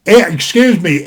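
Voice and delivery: ordinary delivery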